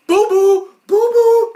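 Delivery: sing-song taunt to mock him. Friends mock Rocco's childhood nickname, loud high taunt. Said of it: "Heffer and Filbert are like".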